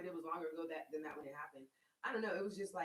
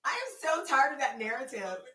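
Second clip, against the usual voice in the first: loud, raised-pitch mock protest; exasperated retort; 5 semitones higher, 11 dB louder than usual. Answering the guy who yelled at her, loud exasperation. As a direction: push it out loudly, fed up.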